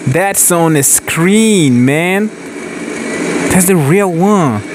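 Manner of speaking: high-pitched, slow amazement; stunned, voice shooting up high; amazed. Real, not acted. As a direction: gasp it out in disbelief. Amazed at a real tank.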